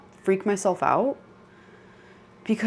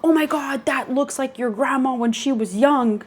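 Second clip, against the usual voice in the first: high-pitched retold amazement; astonished; 7 semitones higher than usual. Mom recognizing grandma in photo, astonished. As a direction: imitate an astonished mother reacting.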